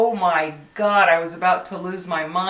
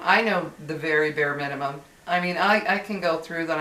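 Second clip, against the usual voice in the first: usual speaking voice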